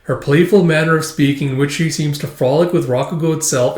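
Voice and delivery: ordinary talk, nothing marked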